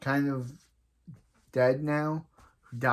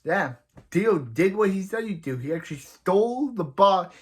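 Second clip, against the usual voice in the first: excited commentary; 6 semitones higher, 4 dB louder than usual. Real, not acted.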